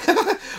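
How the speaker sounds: short high squeal; high squeal laugh. Real, not acted. Squealing as he lifts his frozen foot off the floor. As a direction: let out a quick high squeal. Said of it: "I made that sound".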